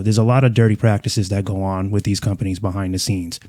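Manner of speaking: usual speaking voice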